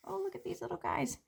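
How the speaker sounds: high, soft and fast, delighted; hushed excited little voice; quick soft marveling. Acted. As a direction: coo over tiny seeds. Seed character marvels at tiny seeds.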